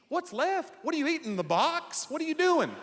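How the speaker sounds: high, rising disbelief; mock baffled outrage at vegans; hammed-up astonishment; incredulous. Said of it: "I go".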